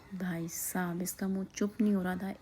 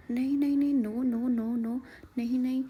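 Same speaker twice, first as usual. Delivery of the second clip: imitating pleading toddler; 3 semitones higher, 4 dB louder than usual. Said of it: "तो इसने फटाफट से फ़ोन उठा दिया".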